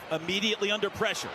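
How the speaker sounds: ordinary delivery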